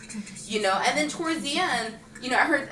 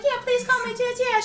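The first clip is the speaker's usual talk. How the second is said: put-on much higher, bubbly eager girl; eager young girl; 13 semitones higher than usual. Girl asking her idol to call her sister, high and eager. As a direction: beg sweetly like a kid sister. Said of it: "she's all like".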